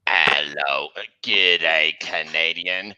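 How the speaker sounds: freight car greeting Squidward. Acted. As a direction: boom it deep as the killer car. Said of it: "he's like".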